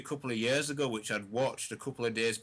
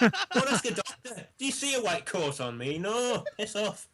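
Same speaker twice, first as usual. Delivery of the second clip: retold irritated telling-off; 9 semitones higher, 3 dB louder than usual. Retelling a telling-off to friend's crying girlfriend, indignant. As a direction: send them away, comically fed up.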